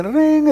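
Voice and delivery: high sung-out ring of a phone; imitated phone ring. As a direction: chirp it up like a ringing phone. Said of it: "And I'll say".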